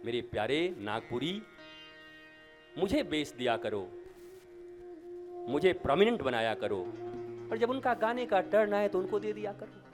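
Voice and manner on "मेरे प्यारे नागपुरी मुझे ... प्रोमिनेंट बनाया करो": ordinary talk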